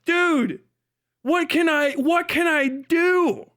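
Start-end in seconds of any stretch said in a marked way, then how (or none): none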